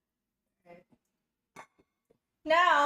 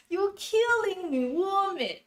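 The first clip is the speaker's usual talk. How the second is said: a playful put-on accent, high and slow, in the style of "You're killing me, woman."; mock accent; 8 semitones higher, 4 dB louder, slower than usual. Teasing her viewers while washing rice, a hammed-up put-on accent. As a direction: ham up a foreign accent, drawn out and high. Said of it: "should I try to do an accent?".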